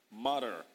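"mutter" with an American flap; American pronunciation. Shown as a demonstration. Preacher demonstrating American "mutter", flapped t. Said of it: "That's American pronunciation now".

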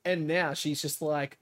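usual speaking voice

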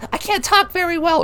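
high squeezed mock voice of a choked wrestler. Mocking the wrestler who was choked, high squeezed voice. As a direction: squeak it out like you've just been choked. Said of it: "whatever the fuck he was doing".

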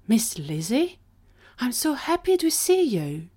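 delighted greeting, pitched high; thrilled to find a friend, voice lifting up; happily surprised. Acted. Gwen greets Miss Lizzie in the cell, happily surprised. Delighted reunion in read story.

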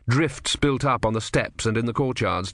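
ordinary talk, nothing marked

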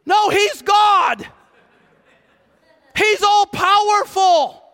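high, slow, full-force shout; impassioned preaching, every word stretched and loud; emphatic proclamation. Real, not acted. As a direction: thunder it out to the congregation.

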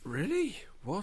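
a high whispered really; whispered disbelief. Real, not acted. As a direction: breathe it out in shock. Disbelief about cards.

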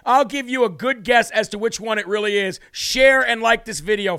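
usual speaking voice

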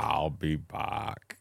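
slow, drawn-out Austrian action-hero line; heavy Austrian accent, very slow; Arnold impression. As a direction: stretch it out like an Austrian movie star. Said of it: "can you do an Arnold".

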